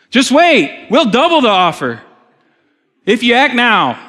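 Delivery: high, loud pitchman hype; infomercial voice. Retold or imitated. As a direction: sell it like a TV ad host. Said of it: "It sounds like an infomercial".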